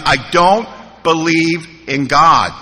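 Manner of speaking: "I don't believe in God" spaced out slowly, word by word; slow, emphatic "I don't believe in God"; slow insistence. Retold or imitated. Newcomer repeating himself to sponsor, "I don't believe in God" slowly spelled out.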